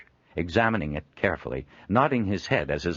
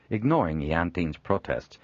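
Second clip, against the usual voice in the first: ordinary delivery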